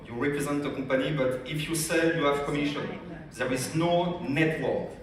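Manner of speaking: ordinary delivery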